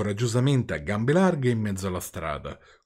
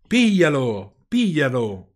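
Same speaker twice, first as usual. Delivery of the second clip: slow, loud, higher yells for help; yelling; 4 semitones higher, 6 dB louder, much slower than usual. Acted. Geppetto yells for someone to catch the runaway puppet. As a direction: shout after the fleeing puppet. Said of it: "urlava Geppetto".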